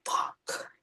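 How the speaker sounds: breathy whispered curse. Retold or imitated. The Hope Foundation realizing Monokuma hijacked it, whispered curse. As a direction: mutter it under your breath in dismay. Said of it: "they're like".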